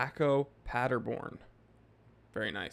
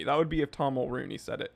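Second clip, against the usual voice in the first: ordinary delivery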